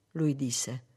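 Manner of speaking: ordinary delivery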